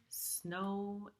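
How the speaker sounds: plain reading voice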